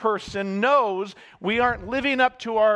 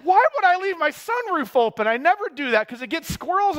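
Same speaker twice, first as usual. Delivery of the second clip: much higher, louder cry of disbelief; bewildered outburst; 10 semitones higher, 4 dB louder than usual. Retold or imitated. Preacher recalls realizing sunroof left open, high, louder, bewildered. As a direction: blurt it out, baffled at yourself. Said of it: "So there's this bewilderment".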